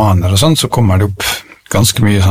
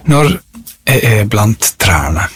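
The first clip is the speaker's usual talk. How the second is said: measured poem recital with long pauses; poetry reading. Acted. Reciting a favourite tree poem to listeners, poetry reading. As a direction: let each phrase of the poem settle.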